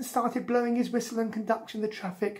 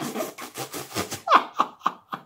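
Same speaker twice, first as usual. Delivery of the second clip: voiceless spluttering staged chortle. A narrator's reading. Another man joining the laughing crowd, chortling. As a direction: wheeze out a long suppressed chuckle. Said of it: "came in chortling going".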